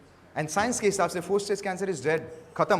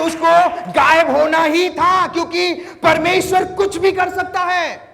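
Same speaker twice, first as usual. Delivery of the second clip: high, loud proclamation; triumphant shout; 10 semitones higher, 10 dB louder than usual. Retold or imitated. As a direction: declare the victory to the whole church. Pastor retells his triumphant reply.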